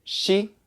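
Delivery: "she" with an English sh; ordinary English "she"; English SH sound. Shown as a demonstration. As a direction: say "she" with the tongue up by the top teeth. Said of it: "So instead of she".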